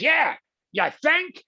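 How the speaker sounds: very high, slow mocking Brooklyn drawl; sarcastic Brooklyn voice; Brooklyn sarcasm. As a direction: sneer it like a Brooklyn wise guy. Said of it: "came from Brooklyn".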